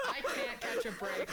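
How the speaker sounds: mock lament through laughter; laughing complaint. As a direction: complain while cracking up. [name] wails in jest, laughing.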